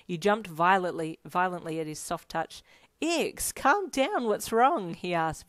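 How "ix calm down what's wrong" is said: raised, worried voice; very concerned. A narrator's reading. Friend alarmed by a sudden jump, concerned and urgent. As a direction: ask with urgent concern, pitched up. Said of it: "very concerned now".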